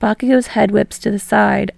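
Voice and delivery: usual speaking voice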